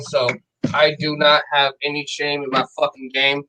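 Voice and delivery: usual speaking voice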